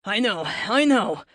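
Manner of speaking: put-on slow, tired Boston accent; a weary Bostonian drawling his reply. A narrator's reading. Fleet to Luke, a tired Boston accent. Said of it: "Fleet replied in his Bostonian accent".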